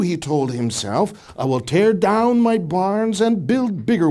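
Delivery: deliberate, emphatic scripture storytelling; parable reading. Acted. Reads the parable aloud.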